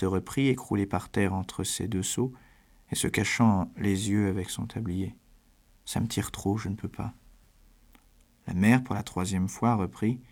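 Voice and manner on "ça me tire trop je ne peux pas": small, softened voice of misery; subdued, tearful-sounding plea; quiet complaint. A narrator's reading. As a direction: complain softly, barely pushing the words out. Weeping daughter hides eyes, complains.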